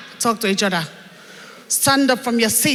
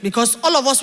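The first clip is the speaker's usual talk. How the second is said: ordinary talk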